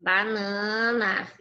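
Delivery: slow, stretched-out echo of the answer; slow approving echo. Real, not acted. Teacher echoing a pupil's correct answer, slowly. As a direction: draw the word out to confirm it's right.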